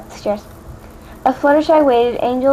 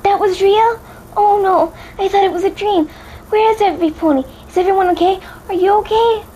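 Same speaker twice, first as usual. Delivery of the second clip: higher, frantic questions; Fluttershy realising the attack was real, panicked and higher; panicked pony; 9 semitones higher than usual. A narrator's reading. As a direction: panic rising in every question. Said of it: "stepped back from Twilight in panic".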